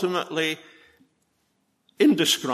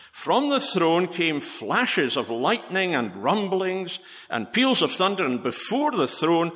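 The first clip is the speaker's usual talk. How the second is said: dramatic scripture reading; 5 semitones higher than usual. Acted. Preacher reading John's vision to the congregation, lifted and dramatic. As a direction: paint the thunder and fire with a lifted voice. Reads Revelation's throne scene.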